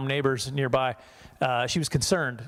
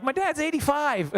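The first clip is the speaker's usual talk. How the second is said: high pleading defense; 12 semitones higher than usual. Retold or imitated. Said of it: "and I was like".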